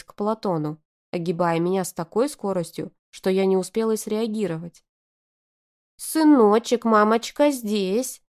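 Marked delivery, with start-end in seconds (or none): 6.0-8.2 put-on high, slow, sugary baby talk; cooing baby talk. A narrator's reading. Mother cooing to the crying baby. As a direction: lisp it sweetly to the baby. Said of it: "засюсюкала она".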